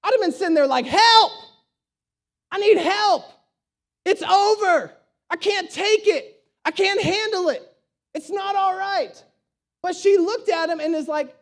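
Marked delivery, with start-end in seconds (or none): 0.9-9.2 high, acted desperate wail; panicked cry for help. Preacher imagines his own response to tragedy, high panicked pleading.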